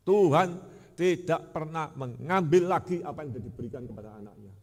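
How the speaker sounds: loud indignant declaration; outraged retort. Retold or imitated. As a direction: blast it out in indignation. Preacher voices critic's denial.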